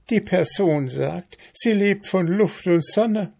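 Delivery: high, scornful ant voice; gossiping ant. A narrator's reading. Ant telling beetle about mayfly, scornful.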